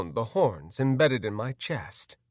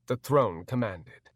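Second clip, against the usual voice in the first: usual speaking voice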